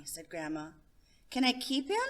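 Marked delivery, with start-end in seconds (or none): 1.3-2.0 put-on higher, pleading little boy; a kid asking to keep a pet; hopeful child. Child Johnny asks grandma.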